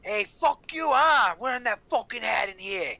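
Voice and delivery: high, loud mock Boston heckle; angry local. Red Sox fan curses the man in the Yankees hat, loud and high.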